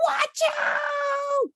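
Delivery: high, sharp cry of alarm; alarmed warning shout. A narrator's reading. Narrator to listening kids, crying a sudden warning. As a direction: yell it like danger is right there.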